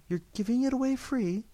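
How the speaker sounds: high, rising surprised question; astonished at a free gift; surprised exclamation. A narrator's reading. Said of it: "she exclaimed".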